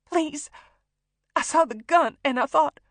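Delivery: high, loud, frightened explanation; desperate tearful plea; begging. A narrator's reading. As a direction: plead in panic, leaving it unfinished. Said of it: "begging him to turn around".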